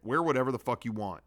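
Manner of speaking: usual speaking voice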